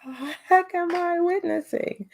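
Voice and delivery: high, slow, bewildered aside; stunned disbelief. Retold or imitated. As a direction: squeak it out slowly, dumbfounded. Mother stunned by teacher's display.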